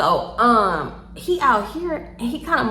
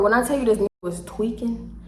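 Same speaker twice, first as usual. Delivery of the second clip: usual speaking voice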